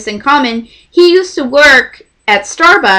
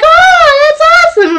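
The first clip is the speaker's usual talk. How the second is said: excited high gush; 5 dB louder than usual. Retold or imitated. Quoting the group's delight.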